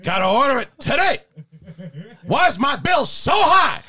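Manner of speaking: Al Pacino. Acted. Actor rails at Bezos over his bill, yelling Pacino impression. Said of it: "Pacino just went from being like that really high voice".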